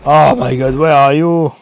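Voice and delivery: loud, exasperated outburst at the screen; oh my god, where are you, half shouted; exasperated call. Real, not acted. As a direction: blurt it out in frustration while searching. Frustrated searching in game.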